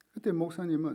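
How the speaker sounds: usual speaking voice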